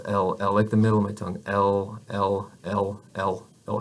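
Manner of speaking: heavy American "L" filling the mouth; English L. Shown as a demonstration. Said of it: "my tongue is kind of raised".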